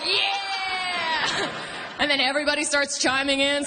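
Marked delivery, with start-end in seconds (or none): 0.0-1.5 group cheer. Retold or imitated. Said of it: "everybody's like".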